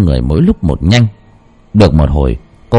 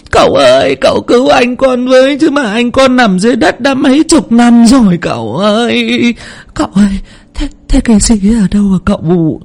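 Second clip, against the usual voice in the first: higher, tearful, pleading; sobbing plea; 11 semitones higher than usual. Acted. Lan sobbing as she begs the spirit for help. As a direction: beg the spirit through tears. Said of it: "mếu máo khóc".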